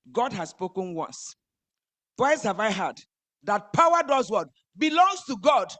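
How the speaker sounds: rising to a loud, high cry; building preaching. Acted. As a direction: build to a shout of praise. Preaching climax.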